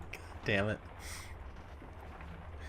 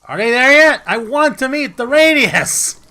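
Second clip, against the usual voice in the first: impatient shout; 13 semitones higher, 17 dB louder than usual. Real, not acted. Gamer impatient to meet the Radius, loud cry. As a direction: demand it loudly.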